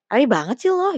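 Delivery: high, loud mock-judgmental jab; catty, snide. Shown as a demonstration. Someone judging another's neat outfit, cattily. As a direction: needle them with exaggerated nosy disapproval. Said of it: "kayak ngejulit".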